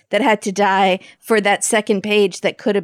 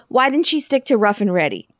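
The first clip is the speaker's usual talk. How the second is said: ordinary talk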